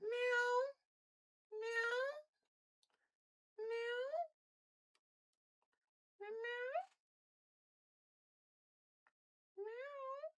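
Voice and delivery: very high squeaky meow; high-pitched kitty call; cat meow. Pretend.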